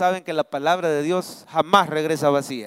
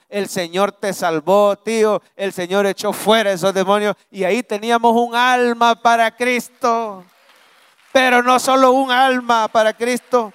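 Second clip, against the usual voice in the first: loud, higher triumphant preaching; preaching at full force; 5 semitones higher, 6 dB louder than usual. Acted. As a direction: proclaim the victory to the congregation.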